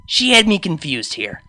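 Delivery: ordinary delivery, nothing marked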